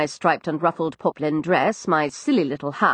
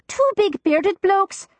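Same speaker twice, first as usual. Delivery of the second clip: put-on higher, young cockney maid; frightened servant girl, higher cockney; Florrie's voice; 13 semitones higher, slower than usual. Narrator voices East End maid.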